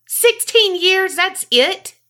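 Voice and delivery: high, indignant questioning; outraged disbelief. Real, not acted. Co-host hearing of a short prison sentence, outraged disbelief. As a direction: pitch leaps up in real disbelief.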